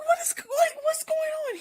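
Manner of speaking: very high, squealed disbelief; incredulous outcry. Retold or imitated. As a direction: act out total bafflement way up high. Acts out her escalating disbelief.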